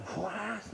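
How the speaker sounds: breathy whip swish imitation; whip swish sound. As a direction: swish it like a lash. Whip strike in joke.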